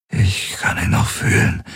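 dry, whispered words; exhausted man, dry and barely voiced; parched voice. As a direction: voice dried out from strain. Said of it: "mit ausgetrockneter Stimme".

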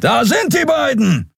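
high, far-off inhuman shout; nonhuman call. Acted. Alien guard spotting the fugitives, inhuman shout. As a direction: cry out in an alien voice. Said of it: "rief eine nichtmenschliche Stimme".

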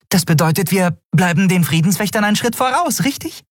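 viel höher, eifrig, mit steigender Frage; hohe Nelen-Stimme. Acted. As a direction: aufgeregt nachfragen wie ein junges Wesen. Nelen hofft aufgeregt. (hohe, eifrige Stimme)

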